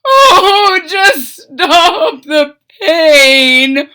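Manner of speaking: high, slow, loud tearful whimper; whimpering fox. Acted. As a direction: whimper through the pain. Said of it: "whimpered the fox".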